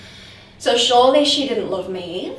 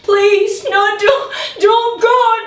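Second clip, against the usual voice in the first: desperate pleading; 9 semitones higher, 5 dB louder than usual. Retold or imitated. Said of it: "I remember him pleading".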